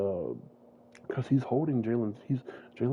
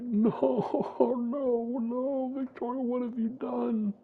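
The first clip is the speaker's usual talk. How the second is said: much higher, strained horrified wail; anguished cry; slower than usual. Acted. Tyler seeing captive Victoria, high anguished wail. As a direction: cry out at the sight of her, stricken.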